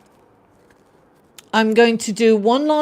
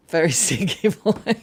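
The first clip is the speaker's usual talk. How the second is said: failing to keep a straight face; words split by a real laugh; laughing through it; 4 semitones lower than usual. Real, not acted. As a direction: giggle breaking into stinky. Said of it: "How can I say that without laughing?".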